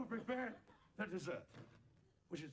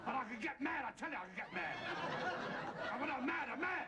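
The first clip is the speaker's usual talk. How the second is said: raised, hammed-up mock threat of getting mad; mock anger; 6 semitones higher than usual. Comic cook warning the reluctant animal he gets mad, hammed-up. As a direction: bluster that you can get mad, half joking.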